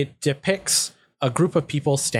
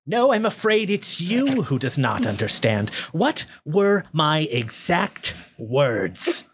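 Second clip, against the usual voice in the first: higher, haughty goblin leader, drawn out at the end; condescending goblin ruler; goblin boss; 3 semitones higher than usual. Acted. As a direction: rebuke an underling in a raised character voice. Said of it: "the goblin on top says".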